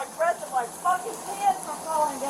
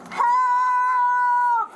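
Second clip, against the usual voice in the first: shouting for help; 6 semitones higher, 4 dB louder than usual. Real, not acted. Woman being arrested yells for help.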